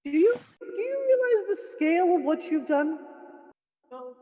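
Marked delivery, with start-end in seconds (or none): none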